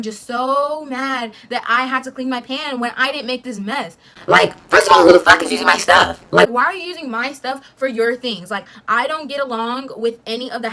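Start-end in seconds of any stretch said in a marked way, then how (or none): 4.3-6.5 loud, indignant outburst; angry rant. Real, not acted. Ranting at the housemates, a loud angry outburst. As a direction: call them out, furious.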